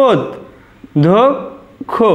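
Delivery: ওদ, ধোক, খো with long pauses between; correct pronunciation, syllabified. Shown as a demonstration. Teacher showing students the correct pronunciation, one syllable at a time. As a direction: say ওদ then ধোক then খো, each apart. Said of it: "এটাই আমাদের শুদ্ধ উচ্চারণ".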